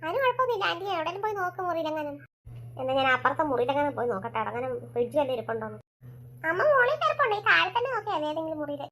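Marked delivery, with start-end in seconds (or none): 2.8-5.8 reassuring mother, lower pitch. Acted. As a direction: lower voice of a mother calming her child.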